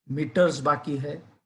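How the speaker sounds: plain reading voice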